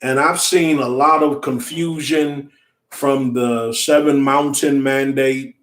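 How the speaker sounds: normal talking voice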